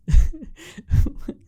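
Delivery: loud, high shriek of laughter; real laughing burst. Real, not acted. Laughing at sister's reply.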